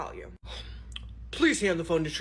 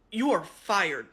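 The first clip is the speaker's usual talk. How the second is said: ordinary talk, nothing marked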